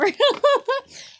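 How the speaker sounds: squealing, high-pitched laugh; high giddy laugh. Real, not acted. Giddy reaction to the anime.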